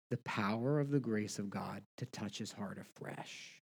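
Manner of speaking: usual speaking voice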